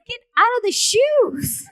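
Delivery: high, loud, drawn-out comic order; hammed-up cry rising into laughter; exasperated mock plea. Retold tearful order, played for laughs.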